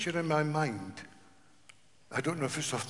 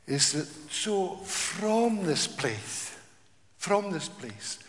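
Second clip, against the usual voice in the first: slow emphasis; 3 semitones higher, much slower than usual. Real, not acted.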